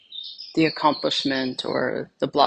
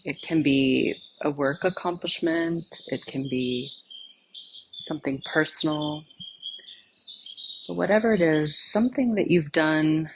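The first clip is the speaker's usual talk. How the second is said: soft, unhurried meditation voice with long pauses; guided meditation; 7 dB quieter than usual. Acted. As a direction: gently lead them inward, phrase by phrase, leaving silence between. Leads a guided meditation exercise.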